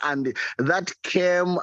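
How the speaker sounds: ordinary talk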